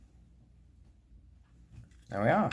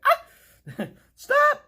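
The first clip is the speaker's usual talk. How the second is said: high, loud startled squeal with a laugh; mock startled yelp; 11 dB louder than usual. Retelling a part clicking, in a high mock yelp. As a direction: squeal like the click scared you. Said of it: "you're like".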